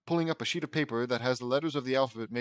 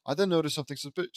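usual speaking voice

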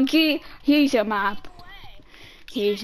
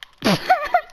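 squeaky giggle; 13 semitones higher than usual. Real, not acted. High squeaky laugh.

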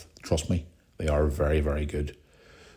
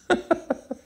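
high squeaky laugh. Real, not acted. Laughing at own story.

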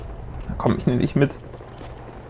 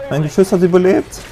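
ordinary talk, nothing marked

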